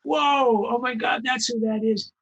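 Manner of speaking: high, awestruck gasp of recognition; starstruck fan. Retold or imitated. Fans in the audience recognizing the voice actors, awestruck. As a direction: react like a fan spotting a star. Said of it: "fans who go".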